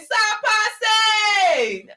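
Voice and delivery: high, drawn-out Haitian greeting call; imitated club shout. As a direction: holler it across a club. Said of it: "go to the club and be like".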